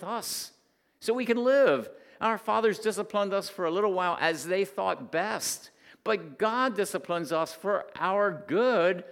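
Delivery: loud, high, driving sermon voice; preaching at full force. Acted. Pastor pressing the point on parents, preaching hard.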